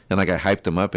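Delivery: ordinary talk, nothing marked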